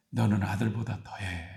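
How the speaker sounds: low, soft blunt reproof; lightly voiced God scolding the missionary. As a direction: deliver it as God's quiet rebuke. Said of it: "하나님이 그러시더래요".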